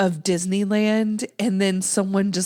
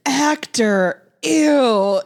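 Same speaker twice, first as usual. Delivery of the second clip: louder, higher recoil of disgust; mock disgust; 4 semitones higher, 4 dB louder, much slower than usual. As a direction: say it as if actors are revolting. Retold disgust at an actor.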